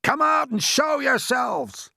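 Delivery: high, loud, slow command; shouted order. A narrator's reading. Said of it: "shouted the chief of police".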